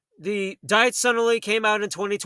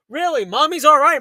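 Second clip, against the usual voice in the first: higher, louder incredulous echo; sarcastic disbelief; 4 semitones higher, 5 dB louder than usual. Retold or imitated. Challenging grandma's reassurance to the child, loud mock disbelief. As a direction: throw it back as an outraged question. Mocking grandma's claim back.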